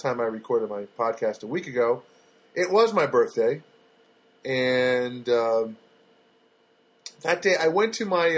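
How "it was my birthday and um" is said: ordinary talk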